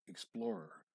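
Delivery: plain reading voice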